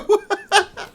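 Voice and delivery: loud, very high laughter; helpless cackling at the absurdity; real laughing fit. Real, not acted. Cracks up at the absurd line.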